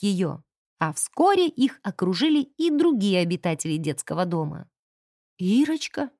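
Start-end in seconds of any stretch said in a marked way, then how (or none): none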